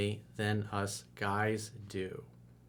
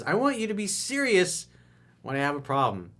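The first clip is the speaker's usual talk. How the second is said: higher, lightly voiced annoyed girlfriend; a hint of her complaint, pitched up; girlfriend's annoyed quote; 7 semitones higher, 3 dB louder than usual. Reading the girl's complaint from an email, pitched up. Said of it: "gotten really annoyed and says".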